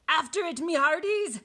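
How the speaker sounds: high, loud pirate order; commanding shout. A narrator's reading. Captain sends crew after the ball, commanding. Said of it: "Braidbeard commanded".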